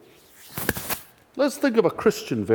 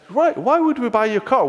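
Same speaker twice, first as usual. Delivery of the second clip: sceptical Scottish parent, high-pitched; a Scottish dad questioning his son; 11 semitones higher, 3 dB louder than usual. Acted. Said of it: "who coincidentally happen to be Scottish".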